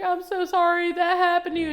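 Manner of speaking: a hammed-up weepy apology, voice up high; mock tearful wife. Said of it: "And she's crying".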